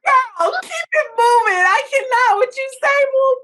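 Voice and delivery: high, loud cracking-up laugh; shrieking with laughter through the words; laughing hard. Real, not acted. Cohost cracking up.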